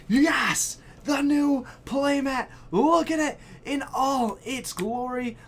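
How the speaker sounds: high, loud, slow gleeful cheer; real delighted high cheer; excited reveal. Real, not acted. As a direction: present it in all its glory. Countdown reveal of playmat.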